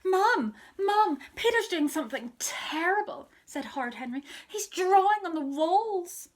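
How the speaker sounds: loud high telling-on; tattling call. Acted. Henry calls Mum upstairs to tell on Peter, loud and high.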